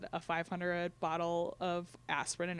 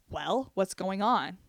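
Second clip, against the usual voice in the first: drawn out very slowly and loud; slow probing question; 9 dB louder, much slower than usual. Retold or imitated. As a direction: press her with a slow, pointed question. Sister probing on the phone.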